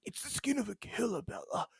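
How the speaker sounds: hammed-up soft confession; movie vampire quote. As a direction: imitate an anguished screen vampire confessing. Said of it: "He's like".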